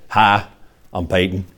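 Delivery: low, soft Southern accent imitation of a tall quarterback. Pastor imitating Peyton Manning's greeting in a low Southern accent. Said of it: "in that kind of Southern, uh, you know, accent".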